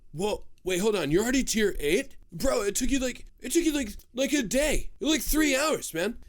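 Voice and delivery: high, loud disbelief, imitating amazed friends; mock incredulous. Banker's Note friends reacting to your fast tier eight, incredulous.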